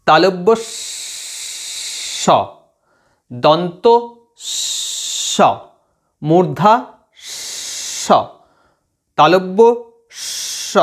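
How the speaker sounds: স drawn out in a long whistling hiss after each name; hissing sibilants. Shown as a demonstration. Teacher demonstrates to students the whistling hiss of স. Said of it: "উচ্চারণ করছি শিস দেওয়ার মতো".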